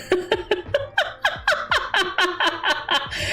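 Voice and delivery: high, rapid cackle; laughing fit. Real, not acted. Streamer laughs at own bad Florida accent, cackling. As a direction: crack up helplessly.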